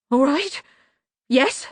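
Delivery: high, rising, uncertain yelp; nervous assent. A narrator's reading. Locksmith humouring Banjo, high nervous question.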